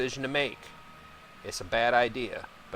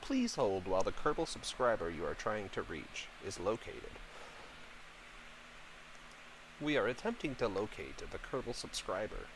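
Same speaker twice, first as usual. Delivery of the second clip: softer automated hold-message voice; mock phone operator; 7 dB quieter than usual. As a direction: play a recorded telephone announcement. Parodies telephone hold announcement.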